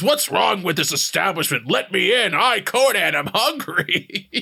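Conan voice. Retold or imitated. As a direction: bluster at the locked door.

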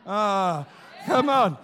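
ordinary delivery

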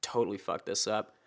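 under-the-breath curse; muttered worry. Retold or imitated. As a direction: mutter it to yourself in mild panic. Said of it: "so I was like".

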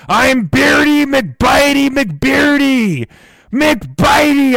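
high, slow shouted name; squawking shout. Acted. Parrot bellowing its name at the host, high squawking shout. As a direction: crow your name like a pirate parrot.

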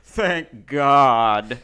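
very loud, stretched THANK... GOD; imitating a kid's big emphatic THANK GOD; drawn-out relief, imitated. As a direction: hammer THANK and GOD slowly, one at a time. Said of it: "that's how he said it, too".

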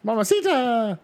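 very high, squealing excited announcer; hammed-up high-pitched announcer glee; excited Jerry Lawler. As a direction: squeal it like a giddy commentator.

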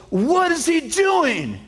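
very slow, high and incredulous; high baffled voice. Retold or imitated. Manager asking his father-in-law, in a high, drawn-out, baffled voice. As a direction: stretch it out in disbelief.

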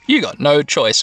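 ordinary delivery

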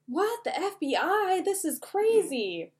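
high-pitched, hammed-up disbelief; play-acted stunned crowd; mock astonishment. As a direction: react in a high, stretched voice of shock. Acted astonishment at FBI arriving.

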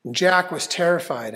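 plain reading voice

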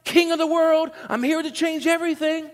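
high, grand proclaiming voice; mock triumphant king. Preacher mocking a conquering king's boast.

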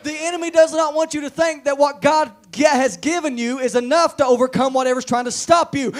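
high, very loud, driving pulpit delivery; shouting revival preacher; full-force preaching. Real, not acted. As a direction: hammer each phrase at the congregation.

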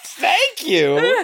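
high, sing-song thank you; milk the absurdity; mock thanks. Friend echoes the film's thanks, high and mocking.

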